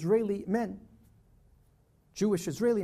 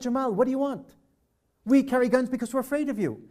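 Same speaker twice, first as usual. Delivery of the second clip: higher and louder, pressing the point; raised, urgent plea; earnest protest; 5 semitones higher, 6 dB louder than usual. Retold or imitated. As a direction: insist he must understand why. Pleading with Jamal in argument.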